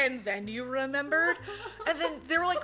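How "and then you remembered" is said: mock-sceptical question. Police doubting the suspect's story, mock incredulity. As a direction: question it back with exaggerated disbelief.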